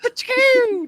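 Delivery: very high, loud ka-choo; high sound effect for taking off; imitated whoosh. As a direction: spit out a zany whoosh noise.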